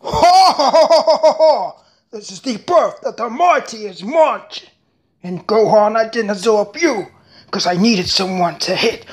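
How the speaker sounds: hammy villain voices with cackles; switching impression voices. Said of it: "if I do well, let me know".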